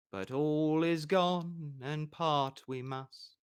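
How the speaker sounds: slow, soft recited verse; poem recital. Acted. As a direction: recite the verse slowly over the chord. Bard recites verse with lute.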